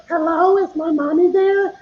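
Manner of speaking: put-on high squeaky kid voice; little kid voice. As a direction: play a small child asking for mom. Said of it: "pretends to be almost like a Mickey Mouse voice, like a little kid".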